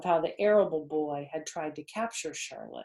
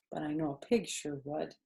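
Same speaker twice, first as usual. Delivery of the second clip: ordinary delivery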